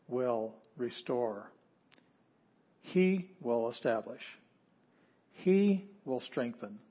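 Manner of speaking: slow, every word weighed; slow emphatic reading. Shown as a demonstration. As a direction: lay each word down slowly. Teacher spelling out emphasis.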